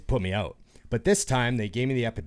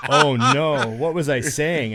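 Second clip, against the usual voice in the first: mock dread; 3 semitones higher, 8 dB louder, slower than usual. Retells alarm at talking under sedation.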